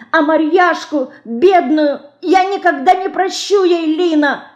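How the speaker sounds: put-on high, tearful child's voice in anger; furious little girl near tears; tearful angry cry. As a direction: cry out through tears of rage. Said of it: "со слезами и гневом кричала мышка".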